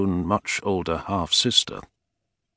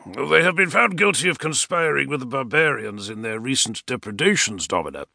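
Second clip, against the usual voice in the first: official; 6 semitones higher than usual. A narrator's reading. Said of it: "Palladius said".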